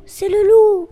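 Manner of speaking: loud alarmed shout of recognition; alarmed cry. Acted. Kid warning siblings it's the wolf, loud alarmed shout.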